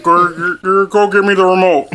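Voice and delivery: halting, mumbled dad voice; mocking dad impression. Son mimics dad asking from his recliner, mocking impression. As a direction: stumble over the words like a winded dad. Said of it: "He literally will sit there and just be like".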